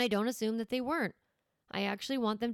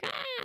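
mock kid yelp. Kid pulling sibling's hair.

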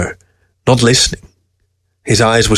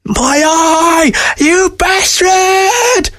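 very high, drawn-out shriek; outraged squeal at the top of the range; screeching weasel; much slower than usual. A narrator's reading. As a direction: screech the curse, stretching it out. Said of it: "screeched Weasel".

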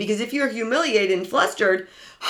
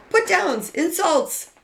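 ordinary talk, nothing marked

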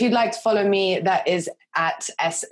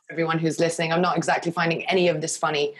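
usual speaking voice

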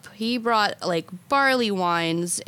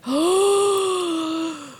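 shocked gasp; 10 semitones higher than usual. Retold or imitated. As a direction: gasp in horror. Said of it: "I was like".

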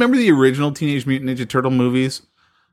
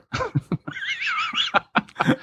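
very high laugh from a sound effect machine; mock sound effect cackle; 8 dB quieter than usual. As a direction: throw in a silly high canned laugh. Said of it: "my sound effect machine".

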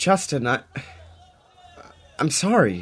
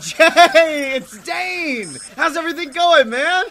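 overjoyed greeting; 12 semitones higher, 5 dB louder, slower than usual. Acted. Dane overjoys at friend answering.

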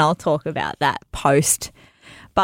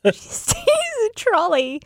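"She has to use a trolley" squeaked much higher in disbelief; incredulous squeal. Acted. Telling her co-host the punchline about the trolley, high squeal. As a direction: squeal the trolley line like it's absurd.

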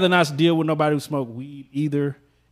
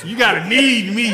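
slow, high, drawn-out insistence; loud insistence; 7 semitones higher, 4 dB louder, slower than usual. Real, not acted. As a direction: insist loudly over the laughter. Shouting over the others in banter.

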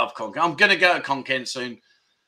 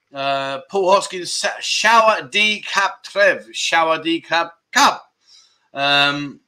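normal talking voice

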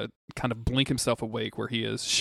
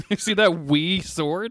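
higher, louder, slow teasing voice; mock Scottish wee; 6 semitones higher, 5 dB louder, slower than usual. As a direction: tease with a mock Scottish lilt. Mocking the tiny sword.

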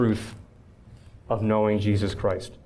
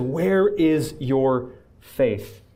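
slow solemn rebuke; much slower than usual. Retold or imitated. Jesus rebuking, final repeat.

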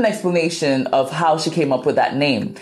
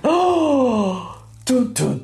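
high hammed-up eureka with dun dun dun; mock eureka; 9 semitones higher, slower than usual. Telling the story of naming her podcast, hammed-up eureka. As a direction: play up the big revelation. Eureka moment, angels singing.

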